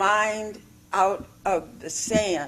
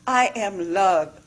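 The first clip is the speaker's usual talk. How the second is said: ordinary delivery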